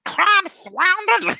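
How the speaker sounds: put-on high creaky old-man exclamation; squeaky old-timer griping; old grandpa voice. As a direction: grumble like a cranky cartoon grandpa. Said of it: "he's like".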